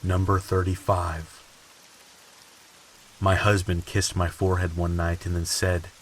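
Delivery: ordinary talk, nothing marked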